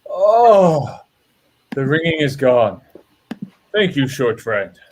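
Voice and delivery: relieved dwarf. Acted. Dwarf relieved after healing.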